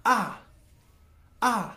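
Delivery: "आ" said high and loud, dropping sharply; fourth tone demo. Shown as a demonstration. Teacher demonstrating the sharp falling fourth tone for students. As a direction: slam "आ" down from the top, Mandarin fourth tone. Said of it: "हम उसको कहेंगे".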